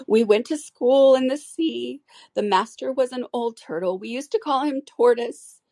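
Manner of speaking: put-on a sad old sea creature remembering school; sobbing Mock Turtle.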